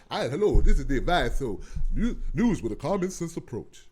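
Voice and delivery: mocking a rival show's cheesy intro; mock TV host. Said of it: "Go, go to the Advice Show".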